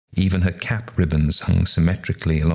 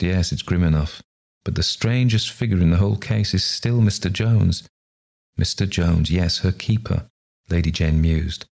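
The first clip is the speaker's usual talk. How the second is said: usual speaking voice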